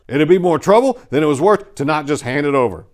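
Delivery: usual speaking voice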